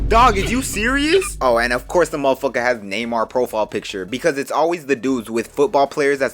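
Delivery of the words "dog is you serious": high, loud and slow; outraged disbelief; shocked. Real, not acted. Reacting to grooming comment.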